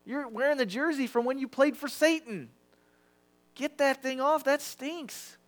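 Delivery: playful disgusted scolding; mock scolding. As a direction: tell them off like a coach in the locker room. Jesus scolds old jersey.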